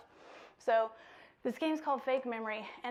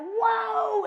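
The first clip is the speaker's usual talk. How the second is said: loud, higher amazed whoa; excited whoa; 10 semitones higher, 10 dB louder than usual. Shown as a demonstration. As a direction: react with big amazement. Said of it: "it's like".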